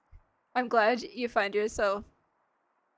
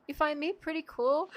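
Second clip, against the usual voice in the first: ordinary delivery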